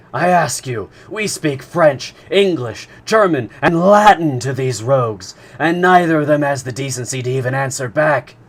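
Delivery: furious harpooner venting; seething. A narrator's reading. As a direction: rage at the silent captors. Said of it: "the seething harpooner".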